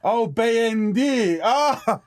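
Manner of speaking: high drawn-out realisation; sudden understanding; surprised. Real, not acted. Realising the word.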